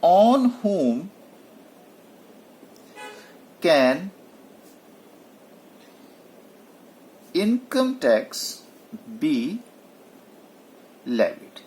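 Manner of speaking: drawn out with long pauses between words; slow dictation. Shown as a demonstration. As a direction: dictate the question word by word. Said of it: "ये question है".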